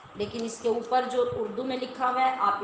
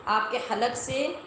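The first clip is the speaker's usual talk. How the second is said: ordinary delivery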